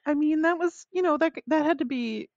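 very high mock-reasonable voice, easing down; sarcastic self-debate. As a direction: argue with yourself in a squeaky, hesitant pitch. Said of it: "sarcastic kind of debate with myself".

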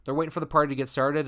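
plain reading voice

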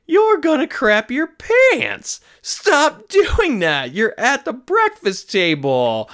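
high, slow, drawn-out mock yell; comically appalled parental outburst; mock scolding. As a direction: scold like an exasperated dad, hammed up. Dad scolding daughter at the table.

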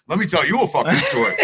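loud, laughing coworker launching a tale; laughing hammy coworker opener; mock storyteller coworker. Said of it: "look 'em up".

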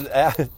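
a bright chuckle mid-story; short laugh. Real, not acted. Laughing while telling story.

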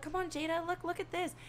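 high, soft mimicked girls' voices; high-pitched mock coaxing; imitated coaxing friends. Imitates friends coaxing her over.

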